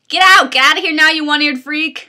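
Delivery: high, loud furious scream. Acted. Quark screaming at George to get out, furious shriek. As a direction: shriek him out of the bar. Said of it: "Quark screamed".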